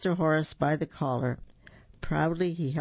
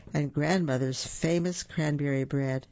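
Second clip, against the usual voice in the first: ordinary delivery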